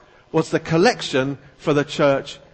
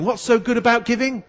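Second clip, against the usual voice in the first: higher, lifted voice of Paul; rhetorical question; 9 semitones higher than usual. Acted. Paul writing to the church, asking rhetorically, lightly voiced. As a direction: pose it as Paul teasing his readers. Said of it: "asking this rhetorical question".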